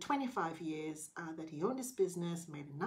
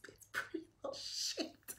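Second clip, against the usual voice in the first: whisper; 7 dB quieter than usual. Real, not acted. Confiding aside about her new haircut.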